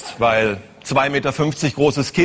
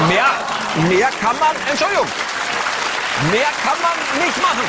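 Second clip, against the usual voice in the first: mock police spokesman; 10 semitones higher than usual. Mimicking police official's excuse.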